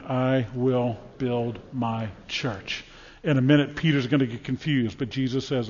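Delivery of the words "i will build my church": slow unison recital. Shown as a demonstration. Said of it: "Say that with me".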